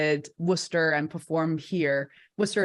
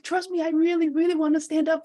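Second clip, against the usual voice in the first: mock pleading; 10 semitones higher than usual. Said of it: "I was like".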